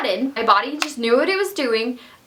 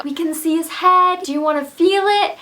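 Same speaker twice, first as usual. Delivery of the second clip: midwives quoted; 6 semitones higher than usual. Retold or imitated. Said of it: "when they're like".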